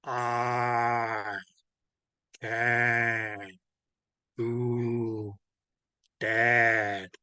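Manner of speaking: low, drawn-out words with long gaps; exaggeratedly slow. Shown as a demonstration. Teacher mocking a request to slow down, exaggeratedly slow. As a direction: stretch each word out, one at a time, pitched low. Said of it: "go a little slower".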